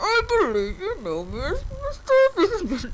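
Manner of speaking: mock the movie villain's muffled voice; Bane impression. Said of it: "doesn't he talk like that in the movie?".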